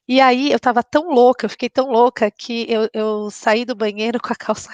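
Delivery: ordinary talk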